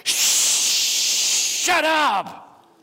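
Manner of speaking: sharp, high, breathy shout; crowd telling him off. Retold or imitated. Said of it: "the guys around them and say".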